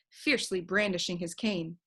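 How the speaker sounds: ordinary delivery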